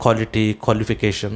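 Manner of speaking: quality as "kholity", qualification as "kholification"; KHO pronunciation. Shown as a demonstration. Teacher summing up the rule, quality and qualification without W. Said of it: "बल्कि खो होता है".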